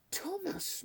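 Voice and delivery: soft, high-pitched murmur of the name; muttered. A narrator's reading. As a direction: mutter it softly to yourself in dismay. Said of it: "muttered Rundle".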